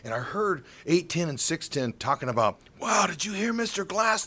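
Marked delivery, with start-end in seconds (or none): 2.8-4.2 high-pitched excited radio-host imitation. Radio hosts on owner's remarks, excited. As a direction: marvel at big news. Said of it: "They were so excited".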